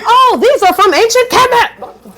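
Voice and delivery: slow, high, loud mock awe; amazed realization. Reading in Barnes and Noble, slow high amazed. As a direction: stretch it out high, wide-eyed. Discovering tarot origins in bookstore.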